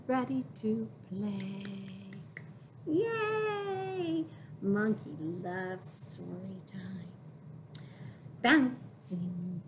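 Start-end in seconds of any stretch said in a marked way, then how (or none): 2.9-4.2 high happy yay; cheer. Real, not acted. Cheering for the baby. 4.6-7.1 soft secret whisper. Acted. Whispering to the babies.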